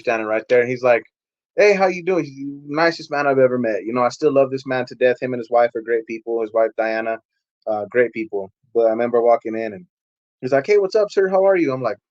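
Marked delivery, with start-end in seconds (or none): 1.6-2.2 higher-pitched cheery hello; friendly pastor greeting. Retold or imitated. Pastor welcoming the newcomer at church, friendly and high. 10.7-11.7 high, polite and cheery; friendly pastor greeting. Retold or imitated. Pastor greeting the newcomer walking in, high and friendly.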